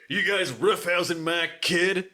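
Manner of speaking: slow, lightly gruff dad challenge; a hint of a menacing mullet dad; mock angry dad. Angry dad confronting boys, mild mock menace. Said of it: "he's like".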